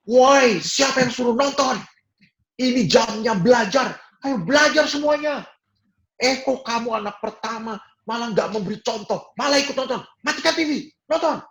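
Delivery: a shouting dad breaking up cartoon time; angry father yelling. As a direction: scold the kids off the TV like a furious father. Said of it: "dan teriak".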